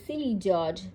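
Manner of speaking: plain reading voice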